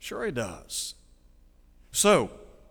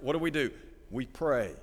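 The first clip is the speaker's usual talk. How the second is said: normal talking voice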